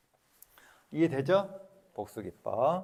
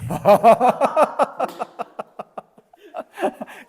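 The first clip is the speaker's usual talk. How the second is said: loud high laugh; 12 semitones higher, 12 dB louder than usual. Shown as a demonstration. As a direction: burst of high, loud laughter mid-demo. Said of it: "유머를 넣어도 돼".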